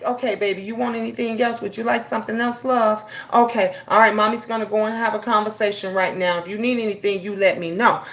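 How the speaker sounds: put-on soft, loving mom to her baby; gentle with child. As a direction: soothe the child while hurrying. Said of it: "take that tone down".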